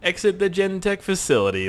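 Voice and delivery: ordinary talk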